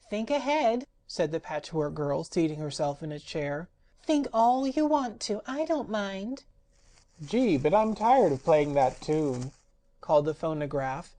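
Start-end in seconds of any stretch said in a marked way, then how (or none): 0.1-0.9 high, breezy doll voice; Patchwork Girl. Acted. Rag doll answering the magician, high and breezy. 4.0-6.4 high, careless doll voice; Patchwork Girl. Acted. Rag doll brushing off the magician, high and airy. 7.2-9.5 louder, rough machine voice; brazen phonograph. Acted. Phonograph grumbling to the room, loud and rough. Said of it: "in a brazen, scratchy voice".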